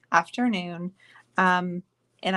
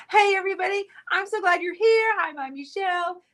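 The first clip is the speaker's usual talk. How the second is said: bubbly restart; 9 semitones higher than usual. Acted. Restarting greeting in higher voice.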